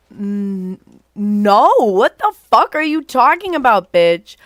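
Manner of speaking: indignant retort. Retold or imitated. Retelling how she shouted down her friend's joke, loud and outraged. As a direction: throw it back at her in disbelief. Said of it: "And I'm like".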